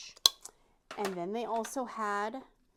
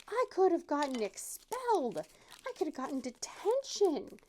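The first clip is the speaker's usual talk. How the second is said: put-on high, louder protesting child; indignant kid; 8 semitones higher, 4 dB louder than usual. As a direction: insist on the disaster, high pitched. Child argues with mom.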